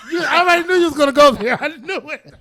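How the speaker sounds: high, fast words tumbling through laughter; laughing protest. Real, not acted. Co-host saw the teasing question coming, high laughing protest. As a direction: crack up while calling out the tease.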